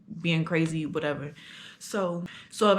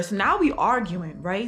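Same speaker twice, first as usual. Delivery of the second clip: ordinary delivery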